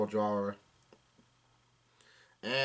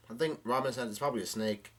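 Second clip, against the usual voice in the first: usual speaking voice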